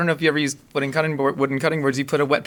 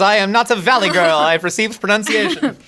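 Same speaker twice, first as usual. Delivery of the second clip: loud, high, over-enunciated mock protest; mock-haughty diction; 9 semitones higher, 5 dB louder than usual. Guest denying a Valley Girl accent, mock-haughty over-enunciation. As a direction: declare it in exaggerated elocution.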